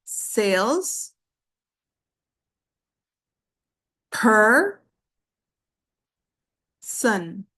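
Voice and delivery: "sales, per, son" with long pauses between beats; syllable by syllable. Shown as a demonstration. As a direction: dictate "sales, per, son" one isolated syllable at a time. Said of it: "three syllables".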